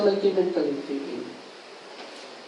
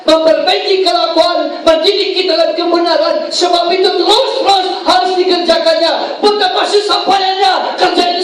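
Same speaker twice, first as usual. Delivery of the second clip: higher, louder impassioned sermon climax; preaching at full force; 10 semitones higher, 3 dB louder than usual. Acted. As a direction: build to a shout as you drive the point home. (khotbah berapi-api dengan suara lantang)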